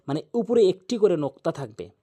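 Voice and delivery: ordinary delivery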